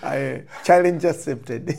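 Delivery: real playful laughter; laughing. Real, not acted. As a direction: accept the challenge with a laugh. Laughing, accepting the challenge.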